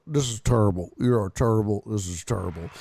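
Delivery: low-pitched, deadpan disapproval; Charles Barkley disapproving. Retold or imitated. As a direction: pronounce judgment low, in heavy disapproval. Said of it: "look at Charles Barkley looking at poor Marine in disapproval".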